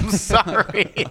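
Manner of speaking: mock apology through laughter; laughing apology. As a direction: apologize but can't stop laughing. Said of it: "I was like".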